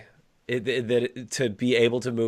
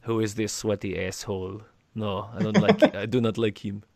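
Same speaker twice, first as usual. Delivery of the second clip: put-on vague Eastern European accent, slightly lower; mock Russian accent; 3 semitones lower than usual. Rival baffled by people screaming Tibbs, mock Russian accent. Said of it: "You just became Russian for a second".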